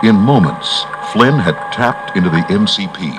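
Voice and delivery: plain reading voice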